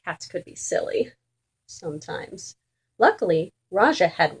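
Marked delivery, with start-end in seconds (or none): 0.0-2.6 whispered, softer aside. A narrator's reading. Narrator confiding to listeners, whispered aside. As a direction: let it slip as a secret.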